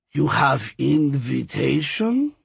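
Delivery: slow, raspy skeleton voice; deep raspy voice. A narrator's reading. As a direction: drag the words out, rasping. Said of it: "with a deep, raspy voice".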